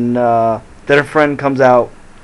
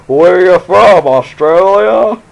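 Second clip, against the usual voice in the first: slow, high, louder goofy movie voice; Dumb and Dumber quote; 7 semitones higher, 9 dB louder, slower than usual. Retold or imitated. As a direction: do the dopey movie line, drawn out slowly. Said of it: "That's a thing from Dumb and Dumber".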